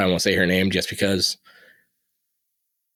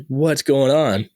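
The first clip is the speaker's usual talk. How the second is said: retold alarm, high and drawn out; freaking out; 6 semitones higher, much slower than usual. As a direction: stretch it out in mild panic, pitch raised. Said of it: "freaking out 'cause I'm like".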